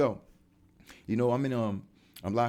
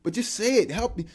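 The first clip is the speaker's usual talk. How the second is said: high, loud goading; high, loud mocking dare; taunting; 10 semitones higher, 7 dB louder, faster than usual. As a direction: egg him on to just say it. Taunting the hater.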